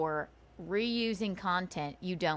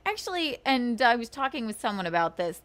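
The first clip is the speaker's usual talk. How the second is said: ordinary talk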